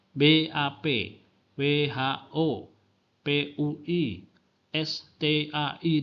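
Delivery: "B A P", "W H O", "P U I", "S T A I" said letter by letter, slowly; letters spelled out. Shown as a demonstration. Spell each acronym slowly, one letter at a time. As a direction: lecturer demonstrates acronyms to students, each letter slow and separate. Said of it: "tanda-tanda pemenggalan untuk memudahkan pengucapan".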